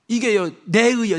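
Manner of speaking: ordinary talk, nothing marked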